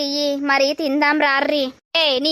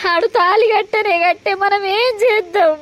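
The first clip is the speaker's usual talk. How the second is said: tearful; 5 semitones higher than usual. Acted. Mother tearful helplessness.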